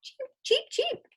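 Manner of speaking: very high, tiny chick peeps; duckling cheeps. A narrator's reading. Reading to kids, ducklings call out in tiny high peeps. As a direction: chirp it like a baby duckling. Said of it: "is what the ducklings are saying".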